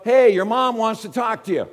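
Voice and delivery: loud, high call across a crowd; yell from the crowd. Retold or imitated. Someone in the crowd calling to Jesus, loud yell. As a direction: call out over the heads. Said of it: "Somebody yells out".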